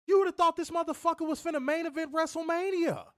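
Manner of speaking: high-pitched incredulous rant. Real, not acted. Podcaster ranting at WWE fans, incredulous. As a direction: pitch it way up in disbelief.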